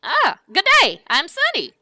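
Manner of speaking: high, loud cowboy hello; booming howdy; hearty greeting. Acted. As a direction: introduce yourself with gusto. Sunny introduces himself.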